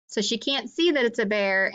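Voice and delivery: plain reading voice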